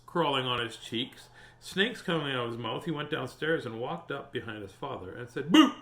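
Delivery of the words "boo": scaring boo. A narrator's reading. Boy scaring father in story.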